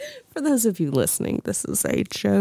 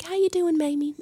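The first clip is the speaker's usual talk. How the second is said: coworker's voice; 10 semitones higher than usual. Retold or imitated. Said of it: "She'd be like".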